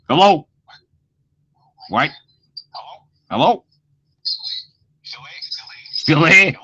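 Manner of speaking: calling into a dead line; loud, rising hellos into silence; call dropping out. Real, not acted. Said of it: "I was just making noises".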